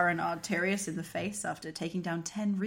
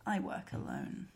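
gruff whisper; 8 dB quieter than usual. Acted. Garrus line, unvoiced.

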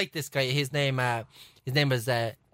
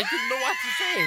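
excited shout. Real, not acted. Retelling his panic at work with a shout. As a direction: blurt it out in a panic.

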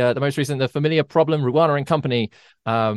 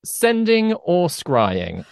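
slow dramatic question; 4 semitones higher, much slower than usual. Acted. Game show style question.

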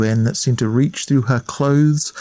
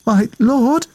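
timid, much higher call; quivering voice; 11 semitones higher, 3 dB louder than usual. A narrator's reading. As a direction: call out nervously in the dark. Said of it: "her voice quivering".

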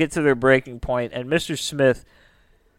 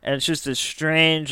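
ordinary delivery

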